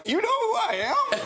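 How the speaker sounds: high, astonished squeal; losing it. Retold or imitated. Fan learns his idol knows him, losing it. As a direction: burst out in starstruck disbelief. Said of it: "I lost it".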